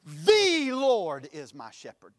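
"The" stretched long and loud, rest falling away; stress on THE. Shown as a demonstration. Preacher shows how emphasis changes meaning, stressing "The". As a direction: lean hard and slow on the word "The". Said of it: "you can place emphasis on it".

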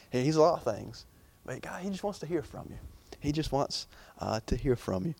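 ordinary talk

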